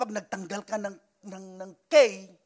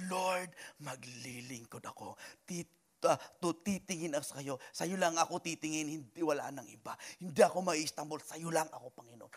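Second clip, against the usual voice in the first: prayer; 6 semitones lower, 7 dB quieter than usual. Pretend. Voicing a believer's prayer.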